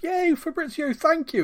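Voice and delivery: high, mock-cheering voice. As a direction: cheer like a grateful fan, high and sarcastic. Everyone cheering Fabrizio.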